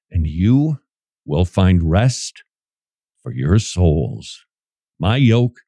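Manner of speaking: low, slow and tender; gentle. Acted. Preacher voicing Jesus' invitation to rest, gentle and low. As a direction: offer rest unhurriedly, voice dropped low.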